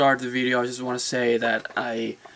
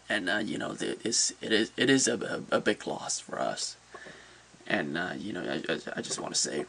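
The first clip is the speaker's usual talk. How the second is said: subdued grief; 6 semitones lower, 7 dB quieter than usual. Real, not acted. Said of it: "is quite devastating".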